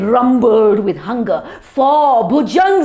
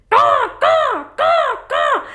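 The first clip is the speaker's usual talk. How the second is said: high crow calls; crow caws. Acted. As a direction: caw at the new babies. Babies hatching.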